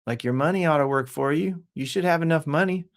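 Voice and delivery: usual speaking voice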